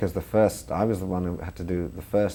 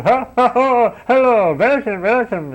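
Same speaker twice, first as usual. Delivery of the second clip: German accent, pitched high and loud, a big fake welcome; hammed-up German waiter; 10 dB louder, slower than usual. German accent of a waiter welcoming gullible American tourists, hammed up. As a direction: lay on the German accent like an over-eager waiter. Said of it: "watching these waiters sending up their countrymen".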